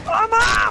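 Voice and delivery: high startled shriek; sudden shriek. Real, not acted. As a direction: let out a sharp shriek. Sudden shock in game.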